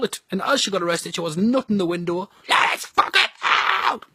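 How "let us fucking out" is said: high, strained scream, drawn out; screamed demand. Acted. Arrested woman nutting the window, screamed demand. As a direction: scream to be let out, stretching each word.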